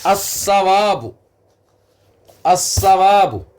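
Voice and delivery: अस्सवाब said slowly with a doubled s and no l sound; sun letter reading. Shown as a demonstration. Teacher slowly modelling अस्सवाब for students, careful doubled s. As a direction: draw out अस्सवाब, sliding straight into a double s. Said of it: "हमने पढ़ा अस्सवाब direct".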